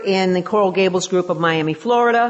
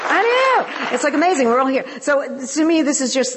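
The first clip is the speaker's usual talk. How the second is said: much higher, bubbling with delight; excited; 8 semitones higher than usual. Real, not acted. Speaker tells the meeting her home group came, excitedly. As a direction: squeal it out, thrilled they came.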